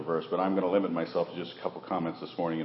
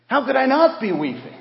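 high, loud anguished plea; Mary's anguished cry; 12 dB louder than usual. Retold or imitated. Preacher voicing Mary at the tomb, anguished high cry.

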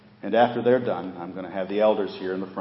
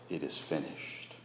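ordinary talk, nothing marked

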